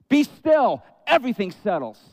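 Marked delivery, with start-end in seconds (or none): 0.1-0.8 firm command. Retold or imitated. Jesus commands the waves.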